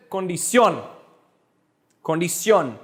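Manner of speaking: condición with a push on the final CIÓN; condición, the ending stressed; stress on last syllable. Shown as a demonstration. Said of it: "but no, it's condición".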